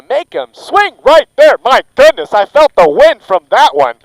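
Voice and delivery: loud, high excited call; thrilled play-by-play shouting. Real, not acted. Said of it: "I get a little too excited".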